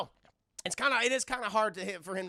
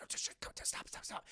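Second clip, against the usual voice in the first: whispered, much softer stops; urgent whisper; 9 dB quieter, much slower than usual. Retold or imitated. Jackie whispering at Chris Tucker to stop, urgent whisper. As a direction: hush him from the wings.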